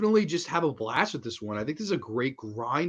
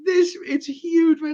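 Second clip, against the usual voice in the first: high, laughing "it's huge"; laughing while talking; 5 dB louder, slower than usual. Real, not acted. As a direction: squeeze it out through laughter, higher. Describing the giant potato, cracking up.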